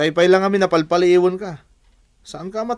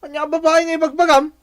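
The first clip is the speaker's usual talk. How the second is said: defensive outburst; 9 semitones higher, 3 dB louder than usual. Acted. Husband denies to his wife.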